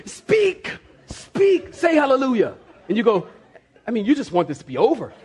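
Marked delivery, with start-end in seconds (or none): 0.1-2.6 high-pitched mock command; hammed-up insistent order; commanding pastor. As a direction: imitate a preacher demanding speech. Pastor commanding with head lock.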